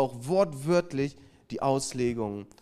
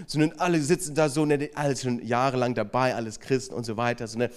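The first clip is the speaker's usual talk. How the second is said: ordinary talk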